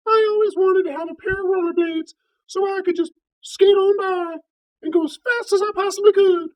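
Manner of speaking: very high falsetto mouse voice; squeaky mouse. Acted. Mouse asking Saint Peter for rollerblades, high squeaky falsetto.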